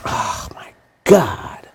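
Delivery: slow exasperated whisper. Real, not acted. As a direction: breathe it out, almost no voice. Exasperated aside.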